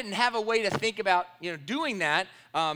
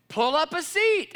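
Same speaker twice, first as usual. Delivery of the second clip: retold friendly invite; 9 semitones higher, 7 dB louder, slower than usual.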